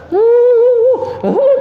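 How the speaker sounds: high loud mock sobbing grunts. Preacher mimicking the friend's wailing prayer, loud high sobs. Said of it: "kata saya".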